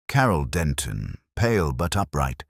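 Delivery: ordinary delivery, nothing marked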